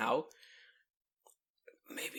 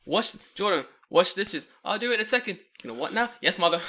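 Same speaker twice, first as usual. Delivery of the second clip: louder, higher acted exchange; a hint of a strict mother and meek son; mock stern mother; 5 semitones higher, 7 dB louder than usual. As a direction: bark the chore orders.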